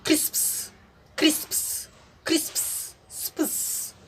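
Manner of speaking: क्रिस्प्स with the p sounded before a final hiss; soft क्रिस्प्स, crisp "sps" cluster at the end; pronunciation demo. Shown as a demonstration. Teacher showing the p is pronounced at word end, pronunciation demo. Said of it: "अर्थात इसे हम पढ़ेंगे".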